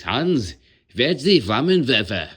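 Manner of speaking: loud, high, hammed-up German accent. Shown as a demonstration. Quoting a well-known line, in a loud German accent. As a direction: shout it out in a comic German accent. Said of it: "The only thing I can do in German".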